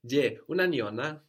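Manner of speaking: "unaniona" lifted into a question sound; rising question tune on "unaniona"; question intonation. Shown as a demonstration. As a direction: make "unaniona" rise like a question. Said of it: "that question sound".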